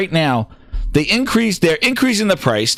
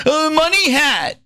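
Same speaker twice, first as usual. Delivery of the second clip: slower, higher whiny money hat; mock crying fan; 8 semitones higher, slower than usual. Streamer mocks fans who cry about money hats, slow and whiny. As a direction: whine it like a sore fan. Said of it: "Nobody was crying".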